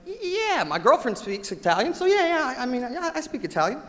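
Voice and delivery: squeaky high bluffing voice, tripping over yeahs; mock nervous bluffer. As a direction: caricature a guy faking he speaks Italian. Boss retells Sean's bluff.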